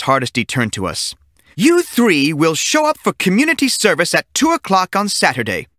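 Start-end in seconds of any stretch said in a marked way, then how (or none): 1.6-5.7 stern teacher. A narrator's reading. Teacher orders community service.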